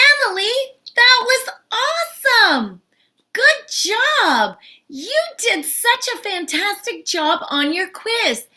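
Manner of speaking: high-pitched, enthusiastic congratulations; delighted praise. Acted. Teacher praising Emily for her quiz, delighted. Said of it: "your teacher might say".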